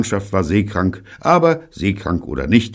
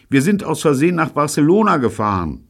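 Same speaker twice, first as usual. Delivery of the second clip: high, quick admission; radio confession; 10 semitones higher, 4 dB louder, faster than usual. A narrator's reading. David confessing the mistake to Neil, high blurting.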